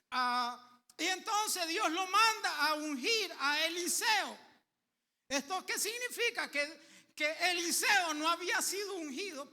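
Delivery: slow, loud, rising preacher's cry; preaching at full force. Acted. Preacher tells congregation what God does, at full force. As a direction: proclaim it to the whole church, slowly.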